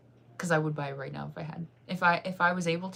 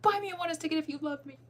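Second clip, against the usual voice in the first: playful plea; 10 semitones higher than usual. Acted. Begging fans playfully.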